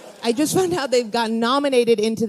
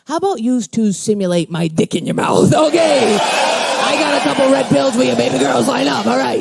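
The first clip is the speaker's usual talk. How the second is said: loud, sleazy movie boss shouting; hammed-up, pushy executive shout; crude Miramax executive; 4 dB louder than usual. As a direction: bellow it like a lewd studio exec. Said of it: "Miramax would have been like".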